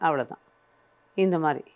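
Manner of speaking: usual speaking voice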